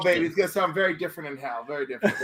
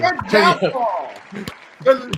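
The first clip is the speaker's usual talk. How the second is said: laughing; 7 dB louder, much slower than usual. Real, not acted. Laughing after the scream.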